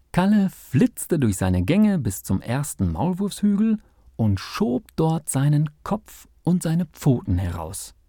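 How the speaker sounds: ordinary delivery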